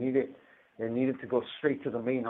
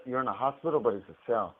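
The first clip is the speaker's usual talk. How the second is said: normal talking voice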